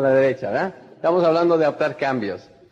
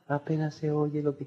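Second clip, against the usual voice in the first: much softer, mumbled under the breath; barely audible; 3 semitones lower, 10 dB quieter than usual. Shown as a demonstration. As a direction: trail off so they can hardly hear. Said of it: "La persona que no está entusiasmada". (casi inaudible, mucho más suave)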